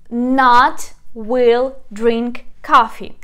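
not stressed, each word slow and loud; slow literal gloss. Shown as a demonstration. Teacher spelling out the literal word order, slow. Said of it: "pay attention".

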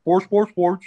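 mock Swedish accent gibberish; goofy Swedish accent, a little livelier; Swedish Chef. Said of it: "Swedish chef from".

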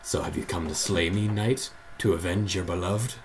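low, soft shadowy villain; quiet, deep villain read; shadow voice. Acted. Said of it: "Do your shadow voice".

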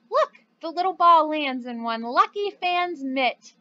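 usual speaking voice

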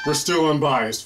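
normal talking voice

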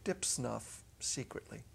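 ordinary delivery, nothing marked

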